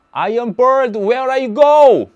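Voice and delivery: high, loud, very slow hammed-up English; mock English speech. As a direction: ham it up calling to the birds. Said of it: "mock English to migrating birds". (과장된 영어 말투)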